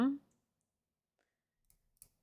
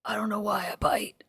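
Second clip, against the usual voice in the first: soft, husky gruff voice; gruff film character voice; 5 dB quieter than usual. Acted. Streamer quoting a film character for chat, gruff voice. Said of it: "say it in the voice of the original quote".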